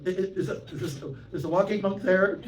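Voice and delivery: high, loud questioning voice; caller on phone. Retold or imitated. Dealer on the phone asking for the walking monk, high and loud.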